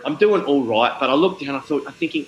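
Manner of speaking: ordinary talk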